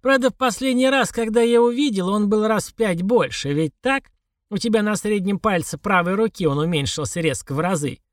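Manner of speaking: put-on high, insistent old crone; demanding old woman. A narrator's reading. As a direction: press him like a stern old woman. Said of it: "спросила она требовательно".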